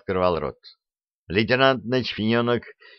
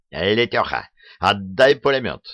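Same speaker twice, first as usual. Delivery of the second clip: ordinary delivery, nothing marked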